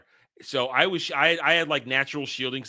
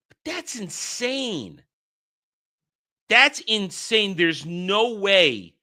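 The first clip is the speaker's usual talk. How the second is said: incredulous; 6 semitones higher, 3 dB louder, much slower than usual. Real, not acted. Reacting to betting on deaths.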